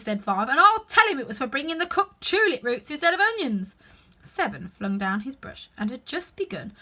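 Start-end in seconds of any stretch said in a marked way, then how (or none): 0.5-3.8 loud, higher telling-off; arguing. Acted. Five tells Two off, loud. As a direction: spill the accusation.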